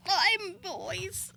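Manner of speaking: put-on nerdy boy whisper. Shown as a demonstration. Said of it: "nerdy boy".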